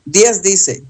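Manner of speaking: ordinary delivery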